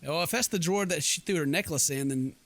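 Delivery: ordinary talk, nothing marked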